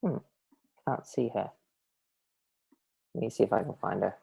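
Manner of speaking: very soft, low talking to herself; murmured aside. Real, not acted. Host still looking for the missing guest, murmured aside. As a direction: murmur it under your breath while searching. Still searching for Holly on screen.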